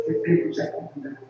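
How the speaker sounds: hushed urgent whisper. Real, not acted. Preacher warning the church to wake up, whispered.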